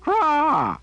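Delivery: high, loud raven caw; piercing caw. A narrator's reading. Raven cawing at Jillian in frustration, piercing caw. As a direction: shriek it like an angry raven. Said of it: "cawed again".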